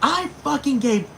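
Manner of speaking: high, loud mock yelling; a mother screaming at her daughter; mock angry mom. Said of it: "Her mom was like".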